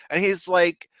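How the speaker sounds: ordinary talk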